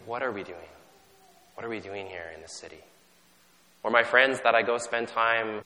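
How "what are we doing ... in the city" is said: inner doubt. Retold or imitated. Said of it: "And I think".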